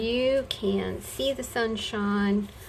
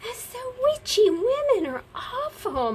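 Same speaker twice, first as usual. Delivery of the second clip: mock whiny agreement; 5 dB louder than usual. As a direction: squeal it as a mock complaint. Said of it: "And I would go".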